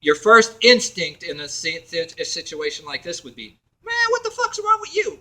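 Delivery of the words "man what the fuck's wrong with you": very high-pitched acted confrontation; squeaky-high put-on indignation; mock outrage. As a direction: act out an indignant first reaction. Acts out angry first instinct.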